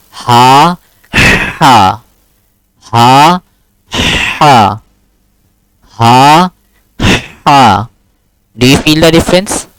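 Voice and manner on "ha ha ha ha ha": ha said plainly, then ha pushed hard from the throat, in turns; alternating ha sounds. Shown as a demonstration. Teacher contrasting two Arabic ha sounds for a student. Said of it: "Do you feel the difference when I'm pronouncing them?".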